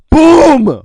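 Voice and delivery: shouted explosion boom; loud boom sound. Pretend. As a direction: yell BOOM like a thunderclap. Lightning strike sound effect.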